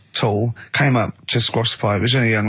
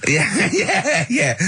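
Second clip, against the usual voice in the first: higher yeah broken by real laughter; laughing yeah; 9 semitones higher, much slower than usual. Real, not acted. Agreeing with friend's joke, laughing.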